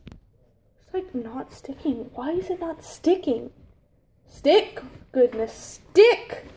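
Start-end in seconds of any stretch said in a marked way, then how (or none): 4.3-6.5 exasperated command. Real, not acted. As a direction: order the stubborn fabric to stick, fed up. Ordering fabric to stick.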